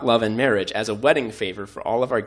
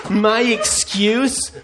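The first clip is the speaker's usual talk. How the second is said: mock self-reproach; 11 semitones higher, slower than usual. Real, not acted. Mock confession about skipping wedding.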